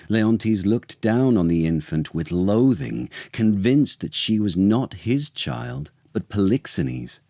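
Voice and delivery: usual speaking voice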